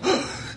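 put-on a sharp, high childlike gasp; mock gasp of fright. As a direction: gasp like a scared toddler. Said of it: "They didn't go".

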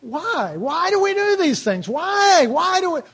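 high, drawn-out wail of why; exaggerated high-pitched plaintive questioning; mock anguished questioning. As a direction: plead the question in a high, swooping voice. Mock questioning of the congregation's behaviour.

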